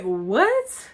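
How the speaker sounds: shocked what. Retold or imitated. Girl shocked by boy's kiss threat, loud yelp. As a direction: blurt it out in disbelief.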